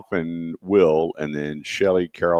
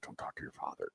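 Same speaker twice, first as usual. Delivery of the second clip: whispered, urgent and low; a mother's hushed whisper to her son; hushed warning; 24 dB quieter than usual. Retold or imitated. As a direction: whisper it so dad can't hear. Mom warns him about angry dad.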